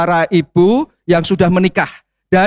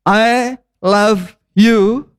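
slow demo; 2 semitones higher, much slower than usual. Shown as a demonstration. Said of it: "coba tulis ya".

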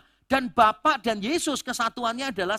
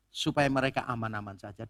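normal talking voice

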